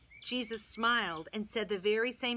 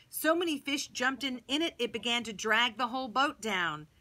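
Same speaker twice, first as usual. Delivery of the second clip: usual speaking voice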